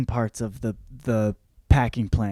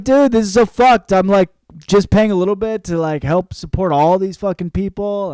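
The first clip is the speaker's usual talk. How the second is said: loud, higher, exasperated rant; mock rant; 6 semitones higher, 6 dB louder than usual. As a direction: vent loudly like you're fed up. Jonas ranting, retold.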